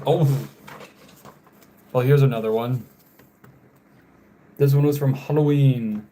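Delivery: usual speaking voice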